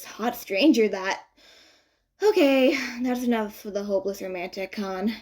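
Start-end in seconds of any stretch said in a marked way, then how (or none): none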